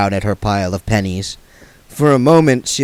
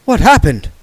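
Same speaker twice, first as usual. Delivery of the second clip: louder, higher startled cry; 8 semitones higher, 7 dB louder than usual. Acted. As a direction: jump at the crash. Gambler startled.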